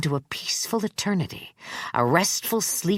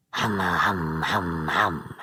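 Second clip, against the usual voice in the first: low, breathy sinister hum; deep, drawn-out creepy old man hum; Charlie Manx voice; 10 semitones lower, 3 dB quieter, much slower than usual. Acted. Manx musing aloud.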